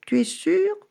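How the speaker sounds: very high, wary question; suspicious cat. A narrator's reading. Cat doubting the dog's offer, suspicious and high. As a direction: ask it like you don't trust him. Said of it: "demanda le chat méfiant".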